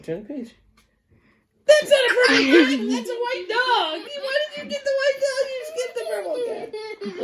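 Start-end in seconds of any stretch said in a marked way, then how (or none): 1.6-7.2 very high, laughing protest; laughing mock outrage. Real, not acted. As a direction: squeal in playful outrage. Mock outrage at skipped page.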